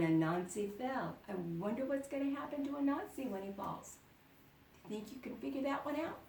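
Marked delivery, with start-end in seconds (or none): 1.3-6.2 hushed quick question; quick aside. Real, not acted. Aside to children listening.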